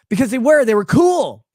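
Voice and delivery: loud emphatic praise; enthusiastic. Real, not acted. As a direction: sell it loud that they were cool. Praising the American troops.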